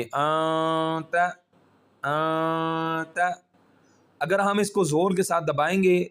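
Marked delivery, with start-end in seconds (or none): 0.0-3.4 आ त said with a hidden nasal hum; ikhfa nasal sound. Shown as a demonstration. Teacher demonstrating ikhfa nasal sound. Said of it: "तो ये sound निकल आएगी".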